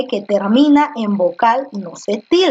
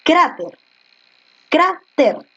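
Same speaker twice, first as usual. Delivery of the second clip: "Cráter" said high with the stress on "crá"; teacher modelling "Cráter" with stress on "crá"; stressed demo word; 5 semitones higher, slower than usual. Shown as a demonstration. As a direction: lean on the first syllable of "Cráter". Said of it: "Tú dices cráter o tú dices cráter".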